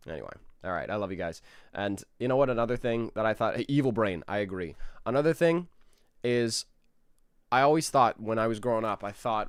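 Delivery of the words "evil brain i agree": ordinary talk, nothing marked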